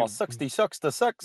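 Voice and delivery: New Zealand accent, vowels clipped short; Kiwi accent. Shown as a demonstration. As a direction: say it like a Kiwi would. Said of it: "you need to say that with the Kiwi accent".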